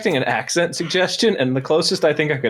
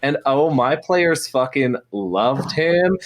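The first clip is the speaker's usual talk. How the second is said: normal talking voice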